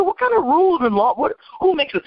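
high-pitched, incredulous questioning; indignant challenge. Retold or imitated. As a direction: demand an answer, pitched well up. Retells challenging the caseworker's rule.

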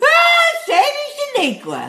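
"Ah" shouted high, then "shady Shaniqua" savoured; gleeful exclamation. Real, not acted. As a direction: relish the nickname with a loud high cry. Gleeful echo of the nickname.

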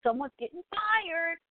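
high, eager call to his wife; excited announcement. Retold or imitated. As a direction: blurt it out like big news. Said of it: "she goes".

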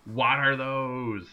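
slow, drawn-out mock question. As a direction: stretch it out like a meme. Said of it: "Somebody went up to him and go".

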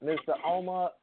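ordinary delivery

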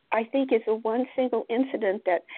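normal talking voice

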